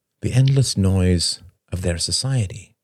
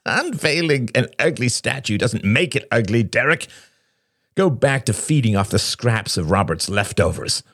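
higher-pitched jeering; Shane, cackling and scornful; 6 semitones higher than usual. Acted. Shane taunts Derek for keeping peace, scornful. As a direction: jeer at him, voice raised in pitch.

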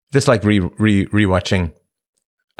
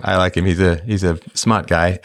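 normal talking voice

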